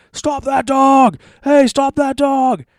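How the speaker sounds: high, loud, slow hammed-up yell; crowd yelling. Imitating the crowd yelling for someone to stop the dog. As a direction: bellow it like a crowd chasing a dog. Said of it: "a parade of people behind her like".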